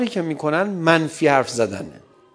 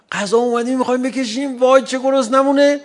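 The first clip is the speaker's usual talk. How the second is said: mock hungry; 7 semitones higher, 5 dB louder than usual. Acting out starving family at dinner.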